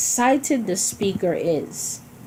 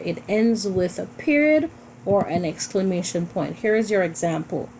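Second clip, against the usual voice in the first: usual speaking voice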